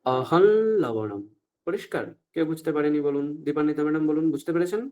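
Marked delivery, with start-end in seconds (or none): 0.1-1.2 আহল লবণম said slowly, nasal ল held; nasal l pronunciation. Shown as a demonstration. Teacher showing the correct pronunciation, slow. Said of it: "আমরা কি বলবো?".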